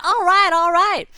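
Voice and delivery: high, loud and drawn out with glee; excited kid. Retold or imitated. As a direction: cheer it slowly and way up high. Kid answers the vendor.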